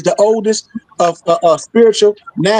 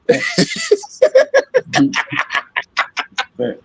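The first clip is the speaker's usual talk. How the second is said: a long, high, breathy laugh. Real, not acted. Laughing after his punchline about being mad, long high laugh. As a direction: laugh hard at your own punchline.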